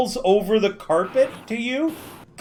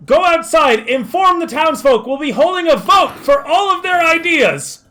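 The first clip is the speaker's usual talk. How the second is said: higher, louder commanding call; loud orders; 9 semitones higher, 6 dB louder than usual. Acted. NPC ordering Majida to inform the townsfolk, loud and high. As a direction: announce the orders across the room.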